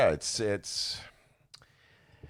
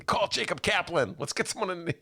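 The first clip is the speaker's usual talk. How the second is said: panicked call; 14 semitones higher than usual. Retold or imitated. Panicked rookie calling for help.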